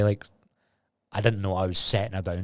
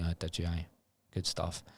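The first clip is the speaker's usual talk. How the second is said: soft, lower, deadpan fake thanks; sarcastic murmur; 4 semitones lower, 13 dB quieter than usual. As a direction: hand it back to mum quietly, faking gratitude.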